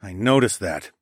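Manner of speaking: plain reading voice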